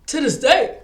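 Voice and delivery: shouted repeat; emphatic shout. Real, not acted. Repeats phrase, now shouted.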